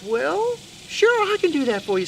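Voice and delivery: lightly voiced high mouse; obliging Mister Mouse. Mister Mouse agrees in his voice.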